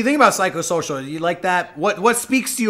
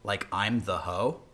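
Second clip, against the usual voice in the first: much lower, slow and hushed; dramatic low voice; 8 semitones lower, 5 dB quieter than usual. Pretend. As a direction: lower the voice way down for a close-up. Dramatic close-up plea to caller.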